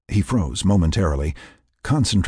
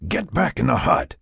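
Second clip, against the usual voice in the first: urgent command, pitch raised; firm order; 6 semitones higher than usual. A narrator's reading. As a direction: order her back with urgency. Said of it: "Mac ordered".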